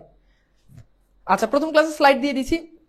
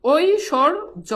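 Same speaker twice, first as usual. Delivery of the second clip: drawn out; 3 semitones higher, much slower than usual. Shown as a demonstration. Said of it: "আর একটা উদাহরণ দিই".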